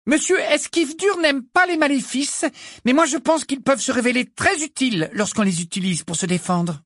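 high and loud, confident; clear, strong voice. A narrator's reading. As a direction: speak up for the whole class. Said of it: "d'une voix claire et forte". (voix claire et forte)

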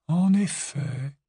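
slow, cool, aloof reply; discouraging. A narrator's reading. Grey Lady coolly answering Harry, slow and aloof. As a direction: keep him at arm's length. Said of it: "Le ton de sa voix n'était pas très encourageant".

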